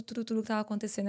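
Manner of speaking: usual speaking voice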